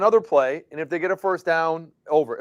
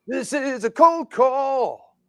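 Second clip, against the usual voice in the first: announcer voice; 6 semitones higher, much slower than usual. Pretend. Play on Stone Cold ad joke.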